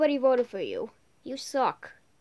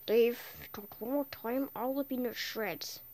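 whispered threat; 2 semitones lower, 8 dB quieter than usual. Real, not acted. Threatening a player under breath.

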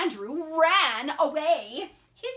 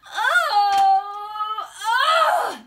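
long, high, loud wail of disgust; horrified yell; 7 semitones higher, 8 dB louder than usual. Acted. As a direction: howl in embarrassed protest. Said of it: "yelled Andrew".

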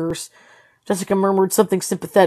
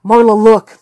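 louder, sharp alarm; urgent call; 2 semitones higher, 8 dB louder than usual. A narrator's reading. Lamar to Marla at the monitor, urgent. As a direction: grab her attention fast.

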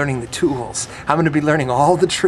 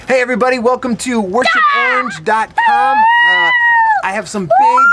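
announcing big news; 5 dB louder than usual. Real, not acted. Vlog intro, big news hype.

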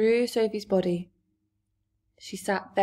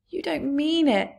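breathless, shocked, higher and hushed; shocked cry; 6 semitones higher than usual. A narrator's reading. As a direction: gasp it out in disbelief. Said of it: "Sophie cried".

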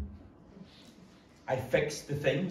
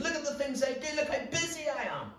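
much higher, smug busy-body voice; 11 semitones higher, faster than usual. Retold or imitated. Pastor mocks the busy churchgoer, higher and smug. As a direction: show off how busy you are. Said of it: "We say".

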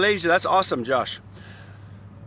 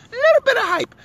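much higher, squealed hype voice; hyped-up; faster than usual. Shown as a demonstration. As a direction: jump way up to show the hype. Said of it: "a little bit of hype in my style".

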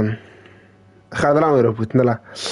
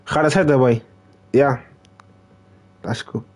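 ordinary delivery

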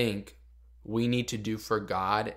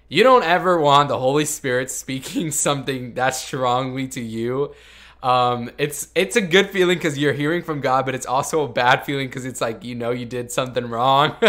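louder, half-laughing warning; 2 semitones higher, 7 dB louder than usual. Real, not acted. Telling the audience about the Holy Spirit, half laughing. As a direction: warn them while laughing.

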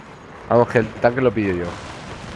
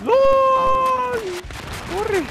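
panicking gamer; slower than usual. Real, not acted. Attacked by a helicopter.

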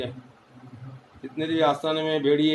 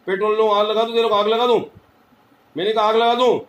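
higher, louder angry shouting; furious threat; 4 semitones higher, 6 dB louder than usual. Real, not acted. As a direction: threaten to burn it, yelling. Healer threatens spirit furiously.

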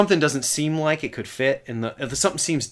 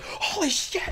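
imitated stunned geek; 5 dB quieter, slower than usual. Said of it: "he goes".

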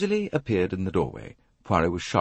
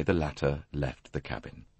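ordinary delivery, nothing marked

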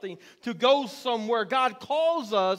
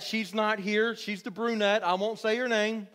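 ordinary talk, nothing marked

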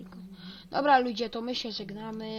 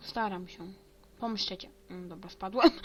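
ordinary talk

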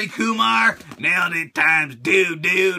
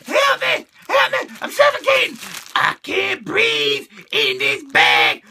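high panicked cries for help; overlapping cries; 13 semitones higher, 4 dB louder than usual. Acted. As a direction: shriek like you're suffocating. Figure trapped in bag.

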